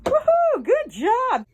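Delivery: high whooping praise; excited cheer. Real, not acted. Cheering pet's trick.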